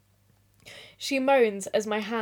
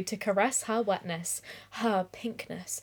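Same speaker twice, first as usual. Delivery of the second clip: whispered; 3 semitones lower, 9 dB quieter than usual. Acted. Reading erotica aloud, breathy drop.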